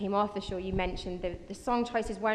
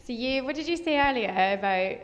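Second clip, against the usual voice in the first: usual speaking voice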